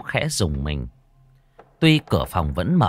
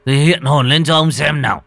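louder, blustering angry challenge; irritated snapping; 3 semitones higher, 6 dB louder than usual. Acted. Old man shouting angrily at the ghost, louder. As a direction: snap at the unseen ghost. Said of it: "Lão bực mình gắt lên".

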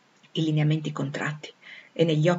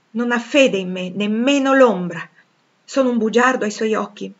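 higher, louder passionate questioning; 4 semitones higher, 7 dB louder than usual. A narrator's reading. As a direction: challenge her doubt with passion. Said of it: "mi chiese con passione". (domande appassionate, con voce più alta)

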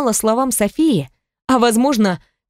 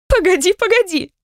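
high, breathless from laughter; laughing pleading; 10 semitones higher than usual. A narrator's reading. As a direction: gasp it out mid-laugh. Laughing fit, tears.

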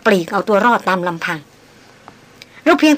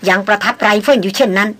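ordinary delivery, nothing marked

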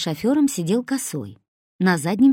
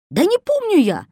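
much higher, louder exasperated yell; 13 semitones higher, 6 dB louder than usual. A narrator's reading. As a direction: bellow it in frustration. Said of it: "заорал Косой". (раздражённо орёт, гораздо выше)

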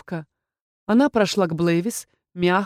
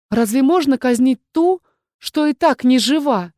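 surprised; 6 semitones higher, 5 dB louder than usual. A narrator's reading. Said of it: "удивилась я".

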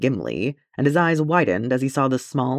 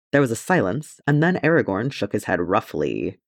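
ordinary delivery, nothing marked

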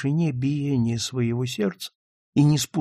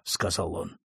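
ordinary delivery

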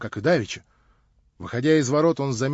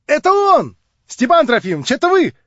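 намного выше и громче, звонко; звонкий девичий восторг; радостный юный голос; 10 semitones higher, 7 dB louder than usual. Acted. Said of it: "свежий, резвый, юный голос". (звонкая юная радость)

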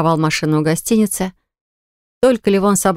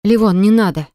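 cry out; 3 semitones higher than usual. Acted. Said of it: "раздался крик Киры".

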